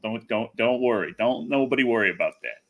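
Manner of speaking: ordinary talk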